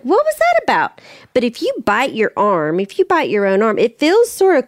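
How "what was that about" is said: a much higher, startled question; puzzled surprise. Retold or imitated. Mom baffled when her toddler snaps at her sister. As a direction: jump up in pitch as if caught off guard.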